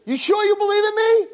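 very high, pleading question; urgent need for assurance. Retold or imitated. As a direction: ask as the friend desperate to be convinced. Friend begs for assurance.

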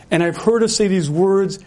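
usual speaking voice

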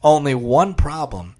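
ordinary delivery

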